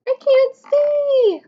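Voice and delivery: high, slow squeaky slug cry. Acted. Teacher voicing the slug, high and slow. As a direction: play the little slug who can't see. Slug character speaking in book.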